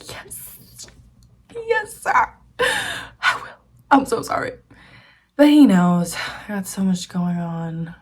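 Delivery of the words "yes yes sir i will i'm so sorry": whispered yes, then high squeaky apology; imitated meek apology. Student apologizing to professor over late lab, mock meek. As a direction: play up a student's grovelling, from whisper to high pitch. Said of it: "And I was like".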